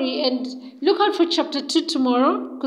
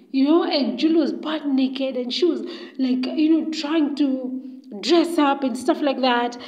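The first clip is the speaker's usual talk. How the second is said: ordinary delivery, nothing marked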